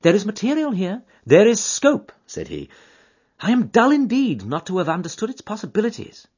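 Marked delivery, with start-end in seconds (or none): 0.0-2.1 higher, louder eager detective; keen detective to his friend; Holmes voice. Acted. Holmes to Watson on the train, eager and keen. 3.4-6.3 higher, louder eager detective; keen detective to his friend; Holmes voice. Acted. Holmes to Watson on the train, eager and keen.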